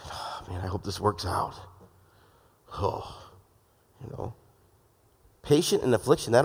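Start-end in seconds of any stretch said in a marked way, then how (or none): 0.1-4.3 low, soft, downbeat grumble; dejected muttering under the breath; grouchy hoping. Acted. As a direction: grumble it quietly, as if half giving up. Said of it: "Don't be grouchy in hope".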